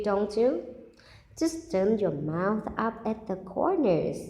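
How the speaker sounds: storybook closing to the listener; story reading. Acted. Teacher ends the story gently coaxing the child. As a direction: coax the listener to smile.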